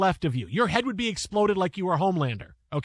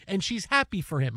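normal talking voice

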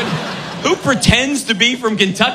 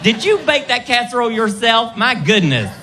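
lilting Southern belle compliments; Georgia peach lilt. Acted. Comic as a flirty Southern woman, Georgia lilt. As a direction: coo admiringly in a Southern accent. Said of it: "it's got that Georgia peach lilt to it".

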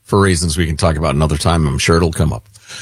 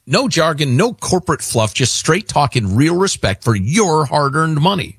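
punchy sponsor read, a touch higher; upbeat host ad read; 7 semitones higher than usual. Acted. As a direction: sell the straight talk.

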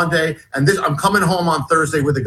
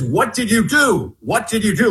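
mock shout, raised in pitch; retold shouting; 7 semitones higher than usual. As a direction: imitate an angry man shouting, at moderate volume. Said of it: "then he starts shouting".

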